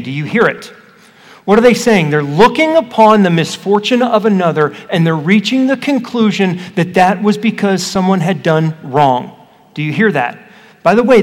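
ordinary talk

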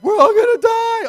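high, loud panicked wail; panicked cry. Retold or imitated. Preacher voicing disciples, loud frightened wail.

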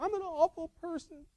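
high-pitched imitation of a tearful kid; mock a child's distraught little wail; put-on high, upset kid voice; upset crying child. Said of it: "Bonnie was start crying".

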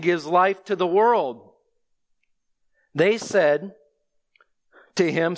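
solemn scripture reading. Acted. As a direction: read the passage aloud to the congregation. Reads the scripture passage aloud.

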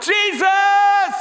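huge, high sustained yell; crying out. Acted. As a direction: holler the name as loud as possible. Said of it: "and he cries out".

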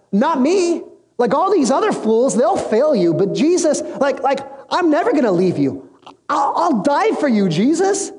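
high-pitched, eager protesting pledge; acted bold vow. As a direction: play an overconfident disciple swearing loyalty. Peter boldly vows loyalty.

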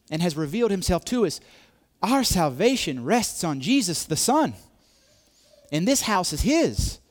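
high, loud climactic preaching; preaching at full force. Acted. Preacher pressing the church to worship, loud and high. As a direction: drive it home with full force.

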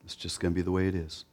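low, soft, quick resignation; subdued, defeated mumble; fatalistic murmuring. Acted. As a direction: murmur it like someone who has given up. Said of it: "start murmuring and move to fatalism".

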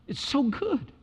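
ordinary delivery

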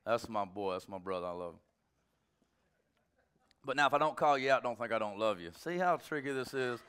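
ordinary delivery

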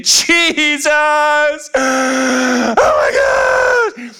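hammed-up high wailing; Mary. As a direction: wail melodramatically, dragging out the cry. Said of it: "falls down at his feet weeping".

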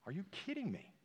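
high, hushed disbelief; can't believe what he's reading; incredulous. Real, not acted. Pastor to congregation, stunned disbelief at the numbers. Preacher reacting to survey stats.

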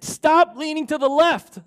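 high, loud shouted scolding; a father snapping at his daughter during bike practice, yelling; angry yell. Retold or imitated. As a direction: yell at the child in frustration. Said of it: "and I yelled".

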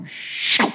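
sharp imitated whoosh; loud, quick "shoo" noise; bottle crumpling whoosh. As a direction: make the sudden whoosh of a collapsing bottle. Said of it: "watched that Gatorade bottle go".

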